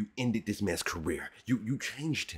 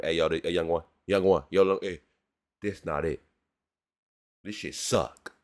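advising friend; 6 semitones lower, 4 dB louder than usual. Retold or imitated. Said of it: "That's what I would've told Snow Day".

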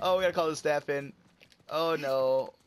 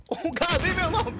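high, frantic plea to be left alone; exasperated yell; 13 semitones higher, 4 dB louder than usual. Real, not acted. Gamer to attackers, leave me alone, exasperated yell. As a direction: cry out like you are being hounded. Pestered mid-fight in game.

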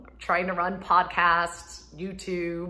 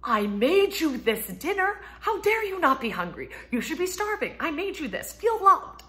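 higher and louder, hammed-up outrage; mock indignant wife; 9 semitones higher, 5 dB louder than usual. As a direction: play the offended cook, pitch climbing. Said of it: "And I'm like".

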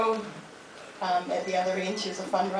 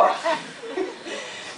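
laugh; 7 semitones higher, 4 dB quieter than usual. Real, not acted. Laugh after son's retort.

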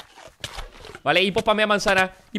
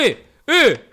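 surprised yelp; 6 semitones higher, 9 dB louder than usual. Real, not acted. Reacting to a near miss.

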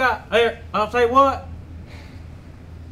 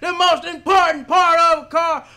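loud, high, outraged yelling; outraged shout; 6 semitones higher, 5 dB louder than usual. Acted. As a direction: blow up at the customer in disbelief. Mechanic explodes at customer.

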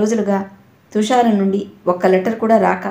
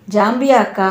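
ordinary delivery, nothing marked

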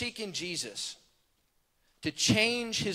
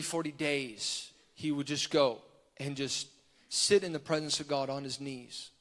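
normal talking voice

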